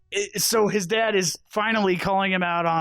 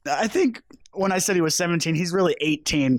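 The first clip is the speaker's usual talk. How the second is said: ordinary talk, nothing marked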